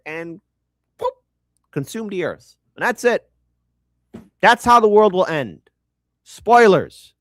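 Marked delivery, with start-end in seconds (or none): 4.4-7.1 loud, emphatic announcement; loud declaration; loud punchline. Real, not acted. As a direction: belt out the spoiler. Punchline of the spoiler bit.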